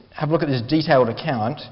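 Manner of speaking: usual speaking voice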